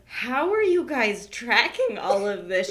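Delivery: mock bewildered question; imitating bewilderment. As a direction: stretch it out like someone lost in the chatter. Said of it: "deer in the headlights".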